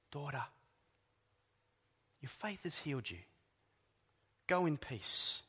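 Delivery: soft, tender reassurance; gentle blessing. Retold or imitated. Jesus gently sending the healed woman off, soft. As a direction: speak it as a quiet comfort. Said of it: "Just like he spoke to the woman".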